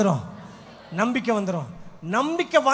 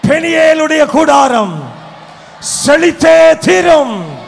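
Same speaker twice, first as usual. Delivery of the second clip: slow, louder proclaimed war-cry; battle cry; 4 semitones higher, 11 dB louder, much slower than usual. Shown as a demonstration. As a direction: roar each phrase out slowly like a battle cry. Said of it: "நான் சொல்றதுதான் வீர முழக்கம் மாதிரி இருக்குது". (மெதுவான உரத்த வீர முழக்கம்)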